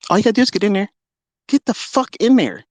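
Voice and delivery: heated challenge. Real, not acted. Ranting challenge to rival traders.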